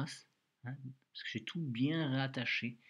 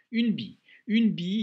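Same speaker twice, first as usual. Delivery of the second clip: announcing the word; 8 semitones higher, 12 dB louder than usual. Shown as a demonstration. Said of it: "il y a une petite difficulté".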